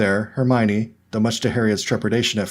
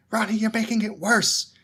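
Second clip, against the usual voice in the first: higher, exasperated scolding; 12 semitones higher than usual. A narrator's reading. Hermione exasperated with Ronnie. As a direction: sigh it out at your friend. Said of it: "declared in exasperation".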